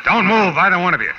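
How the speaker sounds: loud, high order; commanding shout. Acted. Detective holding both at gunpoint, shouting the order. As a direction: freeze them with a shout. Marlowe gets drop on them.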